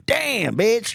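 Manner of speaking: high, sassy mock voice. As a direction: toss it out with attitude.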